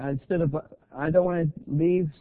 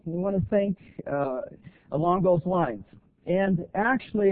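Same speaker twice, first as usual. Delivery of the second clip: ordinary talk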